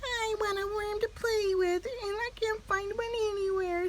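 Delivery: high voice, mock-tearful; crying little bird. A narrator's reading. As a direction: whimper it like a sad chick. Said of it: "said Early Bird".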